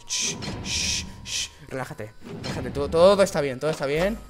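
soft, low hush to calm yourself down; self-soothing hush. Real, not acted. Player calming himself after a jumpscare, soft self-soothing hush. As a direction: talk yourself down after the scare.

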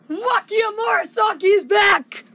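joyful shout, voice cracking; excited shout. Real, not acted. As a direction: announce it with a thrilled shout. Fan announces favorite character's return.

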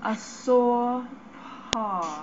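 "sore paw" with a stretched aw; aw vowel drill. Shown as a demonstration. Student drilling aw words for the speech teacher. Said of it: "aw sound".

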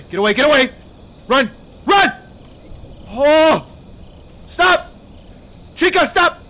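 high, loud, desperate commands; panicked shouting. Real, not acted. Player running from Chica, panicked shouting. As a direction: yell as if fleeing a pursuer.

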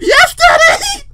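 drawn-out, high hammed-up shriek; very loud joke pleasure cry; mock scream. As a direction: scream it slowly in fake ecstasy. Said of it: "Would you scream really good?".

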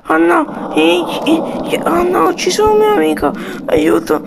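scared toy soldier calling for help, high and loud; panicked soldier. Pretend. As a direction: scream in fear for a friend. Soldier in danger.

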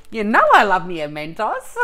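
said through real laughter, loud; laughing. Real, not acted. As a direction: giggle your way through the line. Repeats it laughing.

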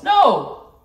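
loud, high sharp no; sudden loud refusal; emphatic no. Real, not acted. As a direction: cut the thought off with a loud no. Rejecting her own idea.

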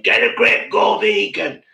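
loud, high angry yell; angry shout. Retold or imitated. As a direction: shout it at them angrily. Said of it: "I've done really angry vlogs".

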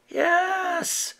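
small shy yes; quiet children's reply; kids answering. A narrator's reading.

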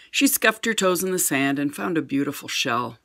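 plain reading voice

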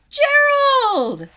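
high, loud call of a name. Acted. Little one calls loudly for Gerald. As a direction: shout for a friend to come. Calling out for a friend.

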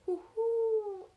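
high, loud hooting; owl hoot. Acted. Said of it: "asked the owl".

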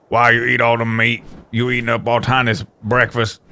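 lightly voiced goofy brute; a hint of a grumbling caveman; Tiny voice. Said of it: "doing the Tiny voice".

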